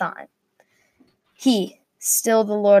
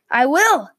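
higher, moderately raised agreement; eager yell; 6 semitones higher, 4 dB louder than usual. A narrator's reading. Daniel agrees as he leaves the watchtower, short yell. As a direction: call it out as you dash off. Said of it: "yelled Daniel".

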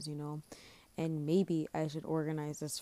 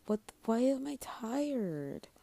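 higher, slow baffled question; disbelief; 7 semitones higher, slower than usual. Real, not acted. Host puzzled at being tired at nine, baffled. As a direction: ask it in real bewilderment. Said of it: "It's literally nine o'clock".

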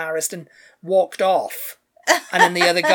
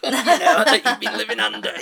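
laughing; 5 semitones higher, 5 dB louder than usual. Real, not acted. Laughing through mockery.

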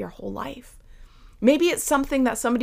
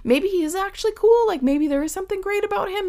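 high, fast eager voice; excited crowd; 9 semitones higher, 4 dB louder than usual. Acted. The crowd deciding Bartimaeus is cool, high and fast. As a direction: hype him up like a fickle crowd.

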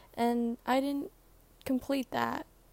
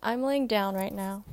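ordinary delivery

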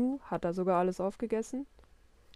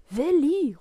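loud, high shout of the name; calling out; 7 semitones higher, 10 dB louder than usual. A narrator's reading. Papa calls Willi away from his mischief, calling out. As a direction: yell for the kid across the flat. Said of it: "ruft Papa".

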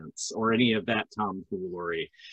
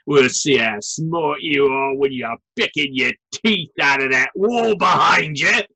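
thick northern British bully accent, rising higher and louder into a threat; 4 semitones higher, 4 dB louder than usual. Shown as a demonstration. Dwarf Gunter threatens a clever party member, northern British bully accent. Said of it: "thick, northern British bully accent".

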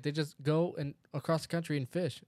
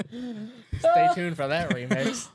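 laughing; 8 semitones higher, 6 dB louder, much slower than usual. Real, not acted. Joking after the mock song.

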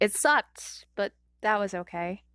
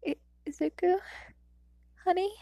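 shaky mother; 9 semitones higher than usual. A narrator's reading. Grieving mother.

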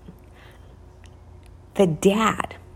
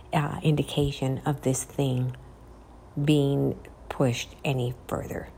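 hushed eerie telling; 4 semitones lower, 8 dB quieter than usual. A narrator's reading. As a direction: let it fall to a whisper. Eerie ending of ghost story.

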